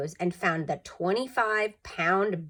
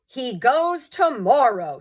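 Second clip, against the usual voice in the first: higher, louder, slow and final; firm Father; 6 semitones higher, 7 dB louder, slower than usual. A narrator's reading. Father deciding Walter goes back, firm. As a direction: settle it with no appeal. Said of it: "said Father".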